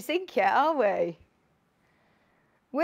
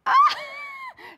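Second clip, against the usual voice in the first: a real high laughing squeal, louder; 5 dB louder than usual. Real, not acted. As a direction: burst out in delighted laughter. Reacting to viewer message.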